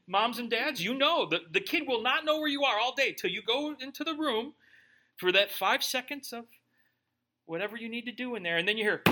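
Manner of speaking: ordinary delivery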